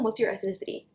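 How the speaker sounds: normal talking voice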